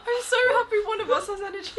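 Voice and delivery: high, loud laughing. Real, not acted. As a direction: squeal it through laughter. Laughing, delirious late night.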